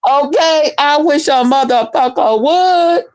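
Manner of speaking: high defiant dare. Real, not acted. Repeats the line, higher.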